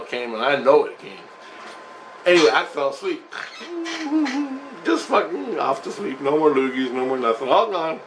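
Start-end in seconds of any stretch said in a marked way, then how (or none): 3.3-4.8 high giggle. Real, not acted. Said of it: "I just started laughing".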